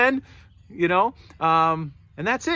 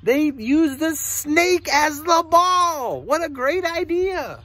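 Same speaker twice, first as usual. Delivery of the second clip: high, slow triumphant delivery; big stretched-out finish, pitched high; excited exclamation; 7 semitones higher, slower than usual. A narrator's reading. Reader celebrates the clever idea, high triumphant exclamation.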